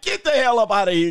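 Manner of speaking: mock snapping, high and loud; hammed-up loud, high fed-up order; imitated angry outburst. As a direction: imitate someone who has finally snapped. Voices the gamer snapping at wife.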